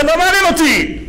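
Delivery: high-pitched panicky dismissal; imitated panic. Driver fleeing the jeep answers the rabbi, imitated panic. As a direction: brush it off in mock alarm, pitched high.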